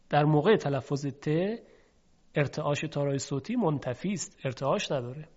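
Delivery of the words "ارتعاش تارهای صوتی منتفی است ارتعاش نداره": normal talking voice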